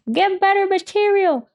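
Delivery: high mock heckle from the balcony; heckler. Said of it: "Subtle call from the top right balcony".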